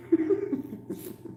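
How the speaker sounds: short high airless cackle. Real, not acted. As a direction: cackle softly at your own joke. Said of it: "I like my cackle".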